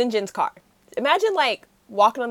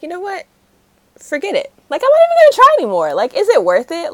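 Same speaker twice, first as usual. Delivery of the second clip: shrill, louder rant, giving up in disgust; fed up and throwing hands up, voice climbing high; exasperated outburst; 8 semitones higher, 12 dB louder, faster than usual. Retold or imitated. Retelling the hero giving up on his friends, frustrated high rant.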